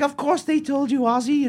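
lightly voiced Sharon setting him straight; mock Sharon. Wife Sharon voiced in story.

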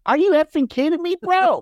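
high, quick burst of mock disbelief; high-pitched, rapid, hammed-up outrage; hyped-up mock troll. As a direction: exclaim it like a worked-up troll, pitch shooting up. Acts out obsessed troll's outburst.

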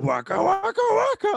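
very high puppet catchphrase; Fozzie Bear. Acted. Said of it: "the only difference is Fozzie Bear".

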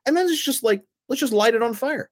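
high, fast sarcastic enthusiasm; mock excitement. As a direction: pitch the absurd idea like it's brilliant. Mock brainstorm of burning money.

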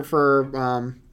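usual speaking voice

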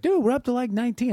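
high, mildly alarmed friend; high-pitched mock alarm; lightly voiced friend. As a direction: break the news with a jolt. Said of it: "it was like".

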